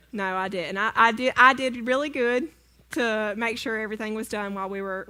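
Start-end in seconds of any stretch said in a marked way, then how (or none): none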